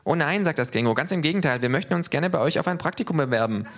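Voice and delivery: high, cheeky kangaroo voice. Acted. Kangaroo teases the neo-Nazis, high and cheeky. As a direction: needle them with a grin.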